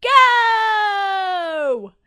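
high, loud excited yell; joyful cheering shout; family yell. A narrator's reading. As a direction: shout it out all together. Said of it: "the rest of the family yell".

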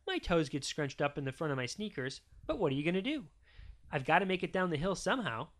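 usual speaking voice

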